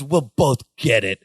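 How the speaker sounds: slow, low mock-grudging reply; mad at each other. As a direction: imitate a sulky standoff, drawing it out low. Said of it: "they're both kinda, like, mad at each other".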